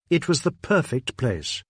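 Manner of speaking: plain reading voice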